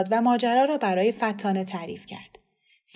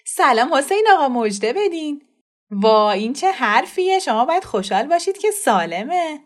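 louder, bright forced greeting; changed tone; 6 semitones higher, 7 dB louder than usual. Acted. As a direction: put on a bold cheerful front. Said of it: "لحن صدای مادر عوض شد". (مادر با لحنی تازه به پدر)

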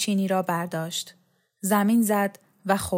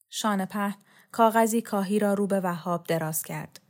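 ordinary delivery

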